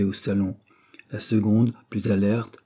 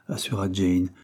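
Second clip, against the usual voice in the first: ordinary delivery, nothing marked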